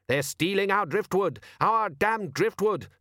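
indignant father climbing in pitch; outraged rant. A narrator's reading. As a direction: build to a high, exasperated huff. Father rants about stolen driftwood.

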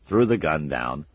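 ordinary delivery, nothing marked

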